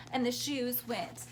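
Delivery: plain reading voice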